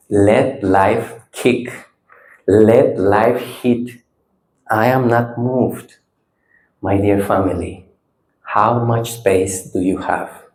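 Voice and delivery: low, slow, measured delivery; calm. Real, not acted. Teacher guiding listeners into inner space, calm.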